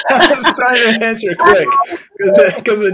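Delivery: high, slow words through a laugh; laughing at her own answer. Real, not acted. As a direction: explain it while still laughing. Laughing while explaining.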